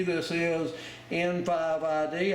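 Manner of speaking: ordinary talk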